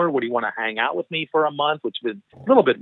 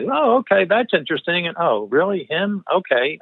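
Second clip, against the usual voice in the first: mildly intrigued; 6 semitones higher than usual. Retold or imitated. Retold polite reactions to names.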